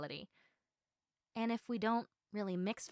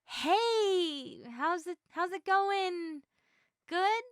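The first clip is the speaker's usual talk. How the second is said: higher, louder, forced-cheery opener; awkward greeting; 9 semitones higher, 4 dB louder, much slower than usual. Retold or imitated. Showing how she awkwardly starts a chat, mock cheery. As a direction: act out a clumsy conversation starter.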